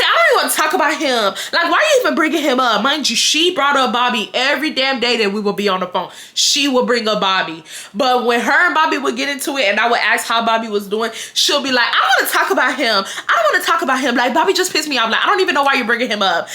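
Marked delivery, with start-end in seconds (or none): 0.0-2.8 irritated snap. Retold or imitated. Friend snapping about boyfriend. 11.9-16.5 irritated whine. Retold or imitated. Friend refusing to talk.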